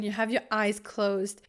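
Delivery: usual speaking voice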